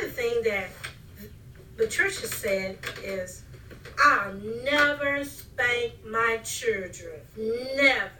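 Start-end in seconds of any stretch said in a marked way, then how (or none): 4.0-8.1 "never" drawn out slowly and weightily; emphatic vow. Retold or imitated. Mother quoting her daughter's vow about spanking, emphatic. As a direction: lean slowly on each "never" in a solemn mock vow.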